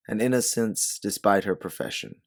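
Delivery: ordinary delivery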